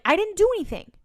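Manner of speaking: high-pitched mock innocence; protesting denial. [name] answering Diana's accusation, protesting denial.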